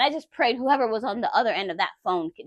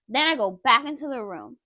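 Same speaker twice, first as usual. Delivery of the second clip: usual speaking voice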